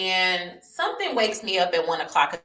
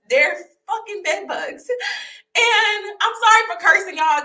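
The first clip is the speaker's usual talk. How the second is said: higher, laugh-cry through the words; half laughing, half crying; laughing upset; 11 semitones higher, 6 dB louder than usual. Real, not acted. Telling viewers about the bugs, a laughing near-cry.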